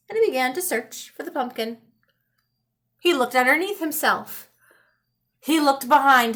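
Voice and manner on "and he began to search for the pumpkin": ordinary delivery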